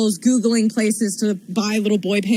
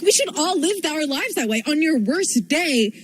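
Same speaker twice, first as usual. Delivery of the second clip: ordinary talk, nothing marked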